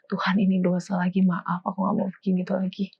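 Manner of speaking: hushed, low confession; quiet repentant prayer. Retold or imitated. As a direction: murmur a contrite prayer to God.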